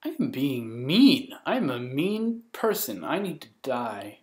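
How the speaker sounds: slow, swooping self-reproach; hammed-up guilty moan; mock remorse. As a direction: wail guilt in play, stretching the words. Mock self-scolding over the story.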